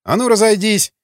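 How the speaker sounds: shouted order. A narrator's reading. The guard orders the fighters apart, shouting. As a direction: bark at them to break it up.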